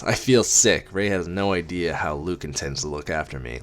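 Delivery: plain reading voice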